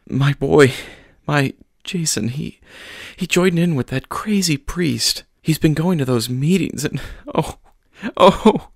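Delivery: high, breaking voice near sobs; sobbing mother. Acted. Mother tells the pastor about her son, near sobbing. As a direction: let the worry crack the words. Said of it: "She started sobbing again".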